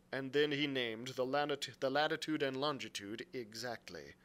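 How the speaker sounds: usual speaking voice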